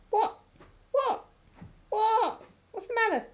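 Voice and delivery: high-pitched pet talk. Real, not acted. Pet fusses, asks higher and louder.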